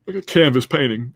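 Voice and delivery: talking through a yawn; words stretched by a yawn. Real, not acted. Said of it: "I'm sorry I'm yawning".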